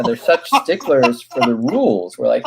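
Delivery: usual speaking voice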